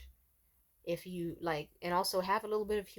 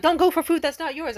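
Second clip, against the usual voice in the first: loud high scolding; 8 semitones higher, 11 dB louder than usual. Retold or imitated. Cashier scolding the customer he blamed, loud high scolding. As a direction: tell her off hard for taking it.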